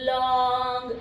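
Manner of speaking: Long stretched long and slow; drawn-out word. Shown as a demonstration. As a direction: drag out the vowel in long. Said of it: "ऐसा आप नहीं बोलोगे". (Long को खींचकर बोलना)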